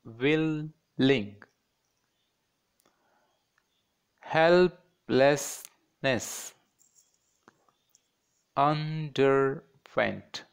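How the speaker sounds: "willing, helplessness, underwent" in slow separate syllables; split into syllables. Shown as a demonstration. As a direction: pause between each syllable. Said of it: "divide these words, fourteen words".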